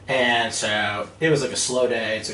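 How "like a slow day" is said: ordinary talk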